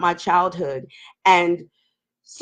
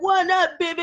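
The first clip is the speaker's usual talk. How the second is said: flirty man; 8 semitones higher than usual. Retold or imitated. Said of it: "they be like".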